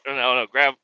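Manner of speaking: normal talking voice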